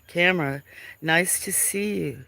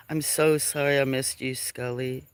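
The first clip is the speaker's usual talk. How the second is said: ordinary delivery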